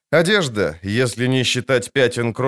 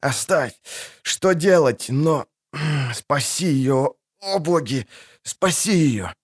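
wounded soldier; 4 semitones higher, slower than usual. Acted. Dying man begging.

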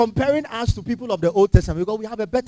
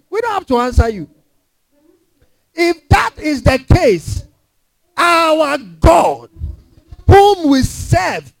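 raised, fervent defiance; defiant young men; 7 semitones higher than usual. Acted. A preacher voicing the three men defying the king, fervent. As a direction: proclaim it boldly before the king. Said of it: "I love this".

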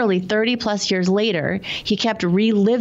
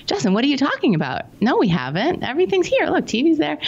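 higher-pitched, lightly voiced mother; dismissive mom; 8 semitones higher than usual. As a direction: play a puzzled mom setting her son straight. Voices mother dismissing son's alarm.

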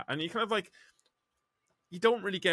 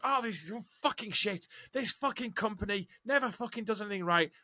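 higher, whiny imitation of a complaining coworker; moaning coworker; 9 semitones higher than usual. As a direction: mimic the guy who gripes at everything. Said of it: "he flaps his arm and goes".

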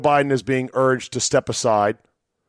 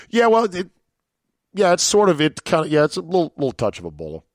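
sheepish mock scientist; 5 semitones higher, faster than usual. Mock scientist sheepishly admits Ebola.

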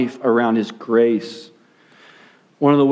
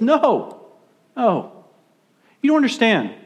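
higher-pitched, insistent defence; acted protest, pitch raised; emphatic objection; 7 semitones higher than usual. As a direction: cut in to defend your friends. Jesus defending his disciples, acted.

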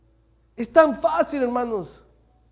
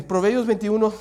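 ordinary delivery

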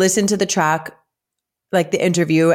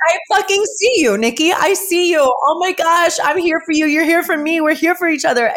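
very high, gushing elation; moved, breathless-with-joy self-affirmation; emotional outpouring; 8 semitones higher than usual. Retold or imitated. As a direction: pour out love to yourself, high-pitched. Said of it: "I'm getting emotional about it".

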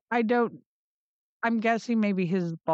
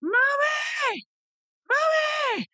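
much higher, shouted calls from the shower; kid screaming for mom; slower than usual. Retold or imitated. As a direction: yell for mommy like a panicked child.

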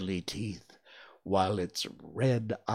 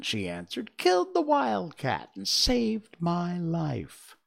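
higher little queen giving orders; lightly voiced queen; 7 semitones higher, 3 dB louder than usual. Mouse queen ordering the mice, lightly higher regal voice. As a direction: command your subjects kindly.

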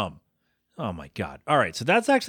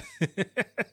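short high embarrassed laugh; awkward laugh; 7 semitones higher, 5 dB quieter than usual. Real, not acted. Awkward self-deprecating laugh.

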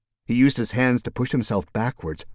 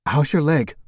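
higher concerned woman's voice; lightly voiced woman; 7 semitones higher, 4 dB louder than usual. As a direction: voice her worried and higher. Mercedes asks, concerned.

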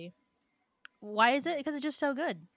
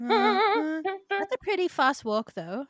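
slowed down reverb; 5 semitones higher, much slower than usual. Pretend.

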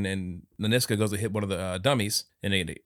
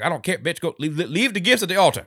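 angry; 8 semitones higher, 5 dB louder than usual. Retold or imitated. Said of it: "She's like".

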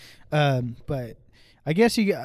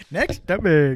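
louder, drawn-out copycat; mocking echo; 6 dB louder, slower than usual. Co-host parrots the host's topic change, mocking echo. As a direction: parrot it back slowly and loudly.

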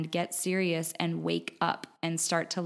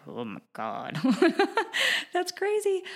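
laughing disbelief; 12 semitones higher than usual. Real, not acted. Podcast host reacting to her guest's waterboarding story, laughing in disbelief. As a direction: react with a real, incredulous laugh.

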